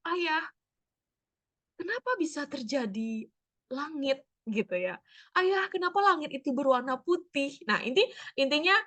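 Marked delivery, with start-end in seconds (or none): 0.1-4.2 higher, lightly voiced child asking father; a hint of a curious child. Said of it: "pertanyaan yang susah dijawab". 5.3-7.6 higher, lightly voiced child asking father; a hint of a curious child. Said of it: "pertanyaan yang susah dijawab".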